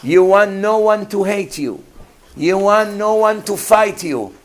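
usual speaking voice

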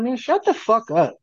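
imitating a teen telling someone to shut up; mock annoyed snap. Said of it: "I'm just like".

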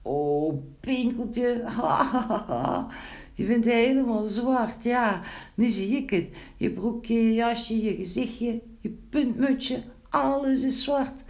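high-pitched, acted laughing fit; laughing hard. A narrator's reading. Wiebelstaartje mocks his sooty friend, laughing hard. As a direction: crow with laughter at the sight. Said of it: "toen moest Wiebelstaartje vreselijk lachen".

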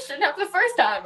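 mock-indignant echo of the guide; sarcastic retort. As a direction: throw "Shouldn't happen" back at him sarcastically. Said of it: "I said".

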